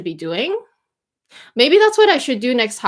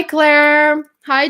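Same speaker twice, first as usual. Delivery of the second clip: ordinary talk, nothing marked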